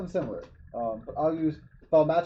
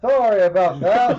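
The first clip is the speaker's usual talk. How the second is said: booming voice; 4 semitones higher, 10 dB louder than usual. Acted. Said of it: "my booming voice".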